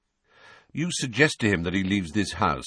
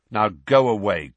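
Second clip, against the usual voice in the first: sharp dismissal; 3 semitones higher, 7 dB louder than usual. Acted. Master dismisses the elf.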